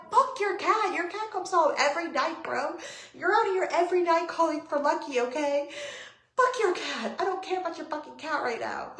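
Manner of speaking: high, loud rant at the neighbor; re-enacted heated outburst; retold angry yelling. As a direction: yell it in exasperation, pitched well up. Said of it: "I'm sorry I'm yelling at you".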